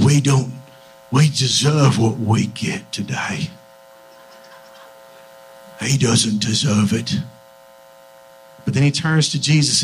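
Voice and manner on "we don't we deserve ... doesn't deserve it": put-on low, slow British accent; British-accented thief. Thief admitting guilt on the cross, low, slow British accent. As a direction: plead humbly in a low British voice. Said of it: "everyone had a British accent".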